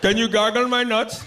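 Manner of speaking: loud, slow, high mock Indian accent; East Indian accent. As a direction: ask crudely in a thick Indian accent. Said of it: "East Indian accent".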